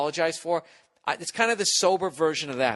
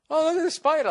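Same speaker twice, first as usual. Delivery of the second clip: much higher, louder airhead voice; ditzy high mock of himself; 4 dB louder than usual. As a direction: parody your own dopey distraction. Mocking his own spider remark.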